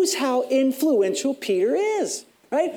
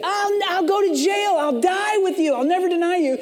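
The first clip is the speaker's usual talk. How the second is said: boasting Peter; 7 semitones higher than usual. Retold or imitated. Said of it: "who just said".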